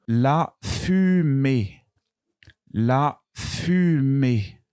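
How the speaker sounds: la fumée drawn out slowly; slow dictation. Shown as a demonstration. Teacher dictating to class, slowly. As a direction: stretch la fumée so U shows. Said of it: "Vous entendez U".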